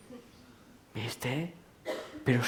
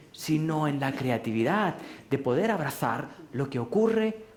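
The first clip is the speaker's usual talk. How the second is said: ordinary talk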